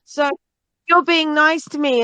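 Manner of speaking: high, sneering mock voice; imitating a contemptuous stranger; mocking someone's contempt. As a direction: sneer it like a smug hater.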